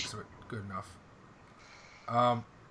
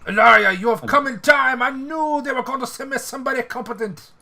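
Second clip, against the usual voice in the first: French officer voice; 10 semitones higher, 5 dB louder than usual. Acted. NPC greets arriving ally.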